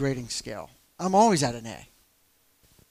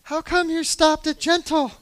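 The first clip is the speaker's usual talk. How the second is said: much higher and louder, slowed, feigning innocence; voice jumps way up; 13 semitones higher, 9 dB louder, slower than usual. Retold or imitated. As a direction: squeak it up high, faking casual surprise. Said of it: "my voice went up a couple of octaves".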